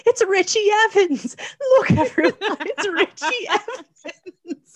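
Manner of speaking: high, loud mock proclamation; hammed-up announcing. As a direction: play it as a grand melodramatic reveal. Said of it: "she's like".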